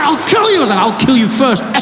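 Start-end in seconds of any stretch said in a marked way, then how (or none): 0.0-0.6 very high, squeaky mock threat; hysterical threat. Acted threat in passenger quarrel. 0.8-1.6 higher-pitched comic threat; mock counter-threat. Acted counter-threat in quarrel.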